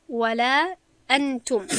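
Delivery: slow nasal-hidden nun, recited Quran style; ولا انتم chanted slowly with ikhfa ghunna; tajwid recitation. Shown as a demonstration. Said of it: "این است مثال یکم".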